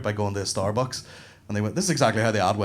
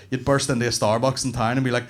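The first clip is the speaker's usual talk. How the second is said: ordinary talk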